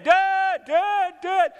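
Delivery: high, loud insistent calls; child yelling. Acted. Children who won't stop calling dad, acted high yell. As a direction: keep hollering right over the answer. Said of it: "they're yelling".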